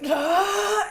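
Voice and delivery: high, drawn-out "ah"; dramatic cry. Acted. Game master voicing a man about to burst, high cry. Imitates character before bursting into glitter.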